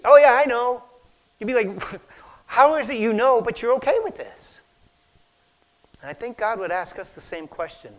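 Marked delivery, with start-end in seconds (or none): 0.0-0.8 breezy loud brush-off. Retold or imitated. Shrugging off kale in teeth. 2.5-4.6 louder incredulous challenge; incredulous question. Retold or imitated. Friend questioning Don.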